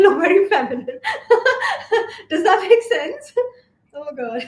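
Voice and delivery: breaking into laughter, voice rising; real laughing. Real, not acted. Vlogger cracking up at her own tiger joke, laughing. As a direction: crack up mid-sentence and keep talking through it.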